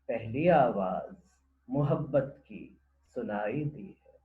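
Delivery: slow declamation with long, lifted vowels; poem recitation. Acted. Presenter reciting the dove verses to listeners, poem recitation. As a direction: call out each line, then soften into the next.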